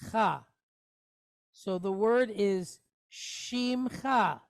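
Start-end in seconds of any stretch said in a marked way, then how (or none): 0.1-0.4 cha with a throaty ch like Bach; guttural cha. Shown as a demonstration. Teacher sounds out cha for students. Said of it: "gives us the sound".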